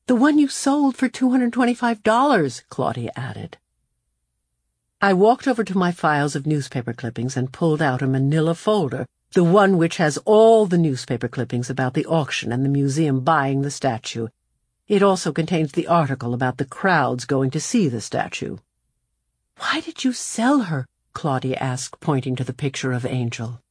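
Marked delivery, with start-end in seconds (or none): 0.1-2.6 put-on high, pointed young girl. A narrator's reading. Claudia adding pointedly. 19.6-20.9 put-on higher, curious young girl. A narrator's reading. Claudia asking.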